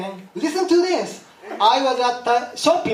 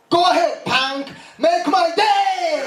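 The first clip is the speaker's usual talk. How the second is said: defiant movie-line shout; 7 semitones higher than usual. Acted. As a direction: throw down the tough-guy catchphrase, stretching the end. Comic quotes movie line at Eastwood.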